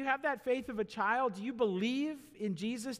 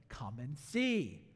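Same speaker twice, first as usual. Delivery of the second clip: gentle invitation; 6 semitones lower, 3 dB quieter, much slower than usual. Shown as a demonstration. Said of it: "I love Philip".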